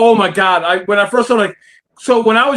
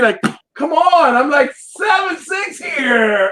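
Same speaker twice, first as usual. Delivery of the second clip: slow exasperated protest; 4 semitones higher, much slower than usual. Retold or imitated. Kid protesting, retold.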